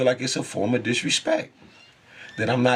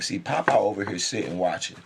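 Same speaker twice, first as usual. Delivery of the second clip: ordinary talk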